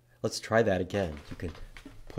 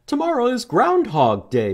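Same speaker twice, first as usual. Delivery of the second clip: much higher, squeaky little bunny; cheerful high bunny chirp; 13 semitones higher, 8 dB louder than usual. Acted. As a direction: pipe up like a tiny bunny. Bunny explains in picture book.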